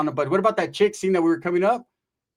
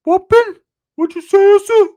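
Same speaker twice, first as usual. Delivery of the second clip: much higher, louder eager squeak; mock Ricky voice; 13 semitones higher, 12 dB louder, slower than usual. Narrator voicing Ricky ready to fight for Pepe. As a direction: squeak it like an overeager sidekick.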